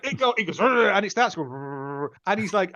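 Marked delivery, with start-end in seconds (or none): 0.6-0.9 rolled "Rrr" engine rev; buzzing motor noise; chainsaw rev. Retold or imitated. Imitates chainsaw start. 1.5-2.1 much softer, low "Rrr" sputtering out; fading engine stall; dying motor. Retold or imitated.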